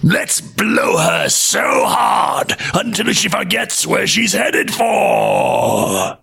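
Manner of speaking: high, slow windy shout; furious whirlwinds. Acted. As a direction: howl it like a gale. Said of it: "they screamed".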